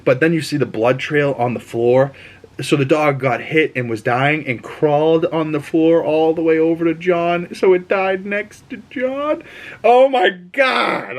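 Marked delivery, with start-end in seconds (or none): 2.6-11.2 climbing higher and higher, slowing on "Oh my God"; real upset. Real, not acted. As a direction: heartbroken outrage as the dog dies. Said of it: "I'm pissed".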